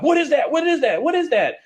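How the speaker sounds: very high, anxious repeated question; tense nervous dog. Retold or imitated. Trainer voicing the scared dog staring at the stranger, high tense voice. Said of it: "it had this tense of, like".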